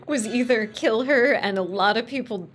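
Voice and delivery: ordinary delivery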